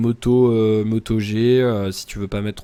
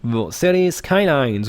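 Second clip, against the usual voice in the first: put-on American accent; 3 semitones higher, 4 dB louder, much slower than usual. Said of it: "tu fais un smiley accent américain".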